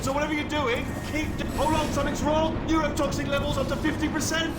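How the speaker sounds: high, tense running report; urgent alarm. Acted. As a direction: call out the danger as it develops. Anxiously reporting changing neurotoxin levels.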